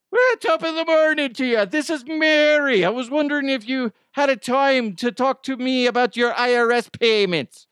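high, loud, hammed-up Irish brogue; cartoon Irish phone scammer; Irish accent. Scammer posing as Mary asks about payments, Irish accent. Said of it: "an Irish guy".

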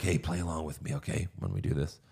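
low, hushed imitation of a secret aside; soft, quick mock whisper; retold whisper. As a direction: drop your voice as if conspiring. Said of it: "he whispers to Adam".

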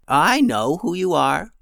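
a lightly voiced creaky old woman, slow; slow old lady creak. A narrator's reading. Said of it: "with an old, creaky voice".

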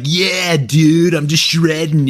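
hyped-up shredder dude impression; mock stoked dude voice; American dude. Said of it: "if that was an American eighty-two-year-old".

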